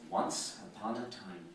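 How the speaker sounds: slow, soft "Once upon a time"; storyteller opening. Shown as a demonstration. To a business audience, a slow soft storyteller opening. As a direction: hush the room like a bedtime story. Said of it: "The very baseline is".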